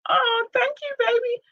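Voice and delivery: high sweet thanks. Real, not acted. Thanking someone in chat.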